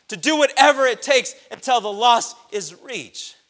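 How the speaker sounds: loud, high-pitched preaching; preaching at full force. Acted. Preacher urging the church to care for the lost, loud and high. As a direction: shout the charge to the church.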